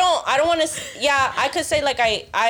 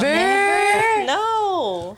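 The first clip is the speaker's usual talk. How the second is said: shocked disbelief; 7 semitones higher, much slower than usual. Real, not acted. Shocked disbelief at never hitting.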